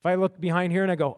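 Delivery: usual speaking voice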